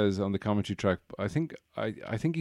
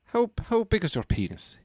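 a much higher, over-the-top mock Irish voice; hammed-up Irish accent; 9 semitones higher than usual. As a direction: ham up a terrible Irish brogue, way up high. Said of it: "the worst Irish accent he's ever heard".

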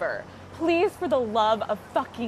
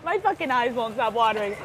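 ordinary talk, nothing marked

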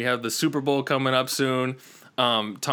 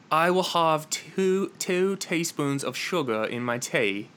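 slow attempt at an English accent; mock proper English accent, drawn out; 3 semitones higher, slower than usual. Shown as a demonstration. American host copying the tea line, English accent. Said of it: "in an English accent".

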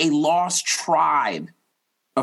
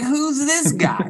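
high, slow mocking voice; suspicious sneer; 14 semitones higher, slower than usual. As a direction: sneer it like a wary stranger.

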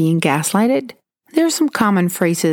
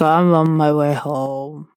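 put-on a slow, slightly slurred drunk mumble; drunk on the phone; slower than usual. Ex calling at dawn, mildly imitated drunk slowness. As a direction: play the ex, tipsy and slow, pretending he's fine. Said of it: "I could already tell that he was drunk".

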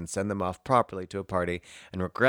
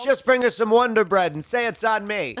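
laughing mock mom; 13 semitones higher, 8 dB louder than usual. Playing the party mom.

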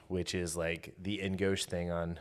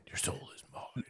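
villain whisper; 9 dB quieter than usual. Retold or imitated.

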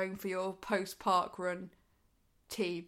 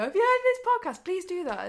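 mock shout; 9 semitones higher, 5 dB louder than usual. Mock shouting out car window.

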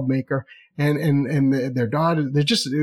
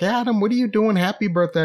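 lightly voiced mom, higher pitch; mom on the phone; 7 semitones higher than usual. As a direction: greet your kid as a phoning mom. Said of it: "My mom's like".

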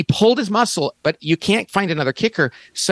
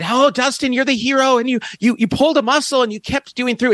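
much higher, excited imitation of a reporter; gushing interviewer; 8 semitones higher than usual. TV reporter to injured winning kicker, gushing high.

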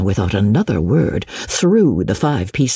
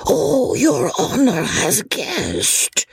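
high, slow, drawn-out amazement; old peddler's long astonished "oh"; 9 semitones higher, much slower than usual. A narrator's reading. Old peddler amazed that Chauvelin guessed the hut, astonished.

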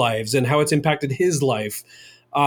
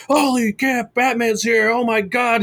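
excited; 9 semitones higher than usual. Retold or imitated. Excited responders cheering.

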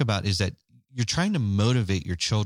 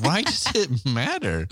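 slow, higher, put-on baffled voice; dismissive shrug; 8 semitones higher, slower than usual. Husband mocking his own puzzlement at his wife, slow and high. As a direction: drawl it like you truly don't see the point. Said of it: "And I'm like".

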